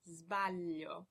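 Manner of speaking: sbaglio starting on a buzzed z; voiced s. Shown as a demonstration. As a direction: draw out sbaglio, opening with a z sound. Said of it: "it is pronounced z".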